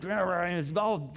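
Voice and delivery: mock grumbling complaint. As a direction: imitate a moaning crowd. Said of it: "grumbling and bitching and moaning".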